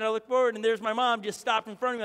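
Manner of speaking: normal talking voice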